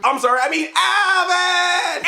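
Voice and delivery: high, slow, drawn-out name call; high stretched mock yell; mock catchphrase finish.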